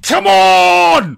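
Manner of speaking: loud, high hype call; rally the crowd; hyped-up cheer. Pretend. Building hype over exhibitors.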